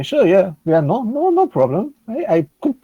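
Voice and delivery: eager yes with stumbling repeats; in-character agreement. Acted. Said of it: "I turn to her and say".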